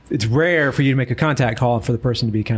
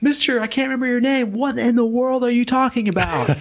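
higher, bewildered question; baffled reply; 11 semitones higher than usual. Retold or imitated. Renter retells answering the screaming neighbor, baffled. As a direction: sound totally thrown by the outburst. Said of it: "I was like".

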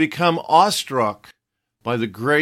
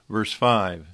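usual speaking voice

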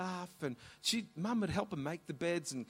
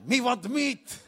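higher, louder insistent demand; insistent broken English; 8 semitones higher, 8 dB louder, slower than usual. Retold or imitated. Mum insisting to the butcher, higher and louder. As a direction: repeat it more firmly.

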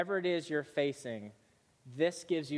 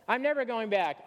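defiant glee; 6 semitones higher, 4 dB louder than usual. Acted. Rapunzel's defiant swing in dialogue.

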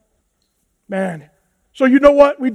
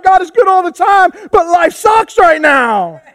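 half-shouted, laughing confession; honest laughing shout; 5 semitones higher than usual. Retold or imitated. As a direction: blurt the honest truth with a laugh. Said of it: "I'm like".

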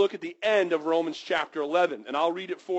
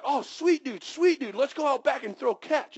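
pitched up, eager buddy voice; excited invitation; 8 semitones higher than usual. Retold or imitated. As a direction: hype your friend up to play catch. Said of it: "But you're like".

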